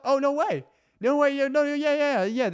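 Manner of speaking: squealing high, loud mock joy; overjoyed roommate, hammed-up squeal; fake delight. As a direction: gush over the offer, way over the top.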